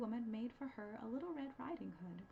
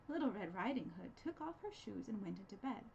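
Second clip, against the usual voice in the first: ordinary delivery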